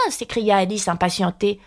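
plain reading voice

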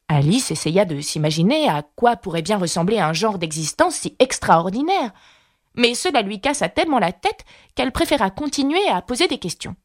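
ordinary delivery

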